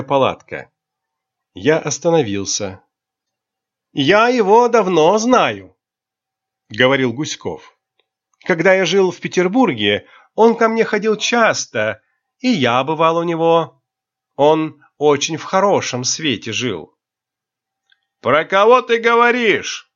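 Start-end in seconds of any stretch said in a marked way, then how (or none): 3.9-16.9 high, bright and boastful; cheerful and lively. A narrator's reading. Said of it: "говорил очень весело и бойко". 18.2-19.9 put-on high, slurring drunk question. A narrator's reading. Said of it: "спросил пьяный голос".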